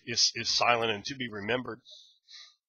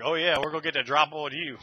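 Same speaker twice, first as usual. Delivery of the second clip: cocky kobold; 5 semitones higher, 4 dB louder than usual. Retold or imitated. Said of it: "Some kobolds will act like".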